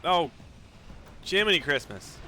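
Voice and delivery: usual speaking voice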